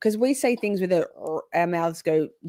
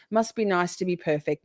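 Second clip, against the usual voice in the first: normal talking voice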